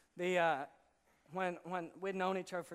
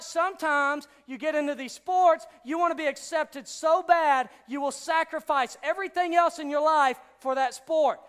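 raised, emphatic preacher's voice; forceful preaching; 8 semitones higher, 11 dB louder than usual. Acted. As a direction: press it on the crowd with energy.

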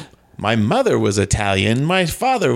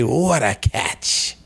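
catchphrase; slower than usual. Acted. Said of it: "but now I say".

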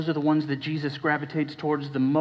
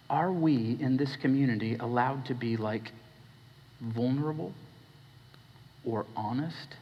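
ordinary delivery

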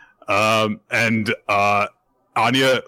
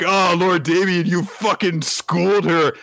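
much higher, slower sycophantic cheer; fawning lackey; 9 semitones higher than usual. Retold or imitated. Crew praising Damien, high fawning lackey voice. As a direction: praise the boss like a toady. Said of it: "Damien's crew are like".

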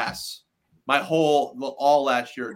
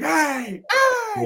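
mock call; 4 dB louder than usual.

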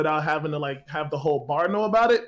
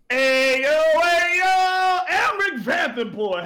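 loud, much higher hail; calling out; 9 semitones higher, 5 dB louder, much slower than usual. Acted. Bard calling to the noble, loud high hail. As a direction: call him over from across the bar. Bard hailing an NPC across room.

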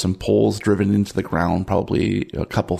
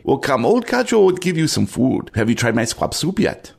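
friendly cook offering a meal, pitched up at first; old Cajo, hospitable; 5 semitones higher than usual. Acted. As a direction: coax a guest to eat, in character. Game master plays Cajo.

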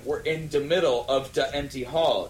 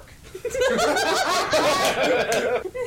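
loud high laughter; 5 dB louder than usual. Real, not acted. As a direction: burst of laughing. Reader laughing loudly.

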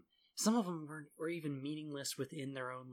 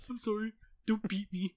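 higher, louder cowering plea; mock pleading; 8 semitones higher, 5 dB louder than usual. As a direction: beg not to be beaten, hammed up. Pleading with abusive master.

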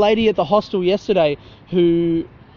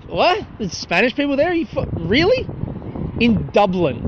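much higher, incredulous "What?"; mock surprised disbelief; 6 semitones higher than usual. Someone told of Spanish tours in Dublin, mock disbelief. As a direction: play someone who can't believe it.

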